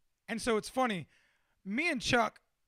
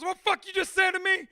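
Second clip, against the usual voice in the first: high, loud confrontational yell; mock furious, pitched-up shout; outraged challenge; 9 semitones higher, 7 dB louder than usual. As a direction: explode as if picking a fight. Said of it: "Then Dylan goes".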